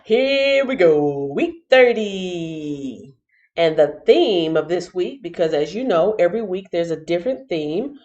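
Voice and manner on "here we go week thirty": slow drawn-out announcer; drawn out announcement. Acted. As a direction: stretch it out like an announcer.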